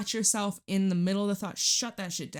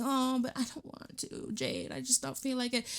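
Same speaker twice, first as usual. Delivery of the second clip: higher, softer whining excuse; whiny reluctant viewer; 5 semitones higher, 5 dB quieter than usual. Retold or imitated. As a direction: sulk like someone avoiding the task. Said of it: "You might be like".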